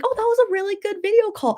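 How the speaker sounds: high, put-on mom voice, pleased; mom's voice. Daughter imitating mom's first reaction, high put-on voice. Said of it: "her first thing was like".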